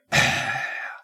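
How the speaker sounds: heavy exhaled sigh; acted exasperated sigh; weary dad sigh. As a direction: breathe out like a fed-up father. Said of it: "the dad's just like".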